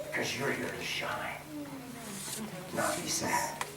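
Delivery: unvoiced whispered point; hushed preacher's whisper. Real, not acted.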